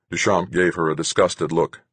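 ordinary delivery

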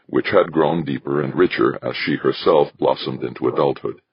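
ordinary delivery, nothing marked

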